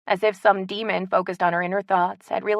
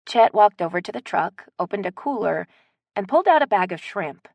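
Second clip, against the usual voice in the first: ordinary delivery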